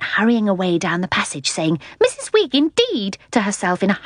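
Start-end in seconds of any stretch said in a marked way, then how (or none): 2.0-3.2 piping indignation to oneself; very high, thin, affronted lady; high, thin, outraged. A narrator's reading. Lady hurrying down the passage to herself, thin outrage. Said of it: "high, thin, outraged voice".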